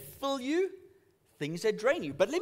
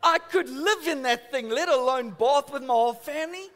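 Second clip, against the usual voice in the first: ordinary delivery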